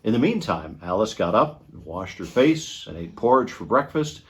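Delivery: ordinary delivery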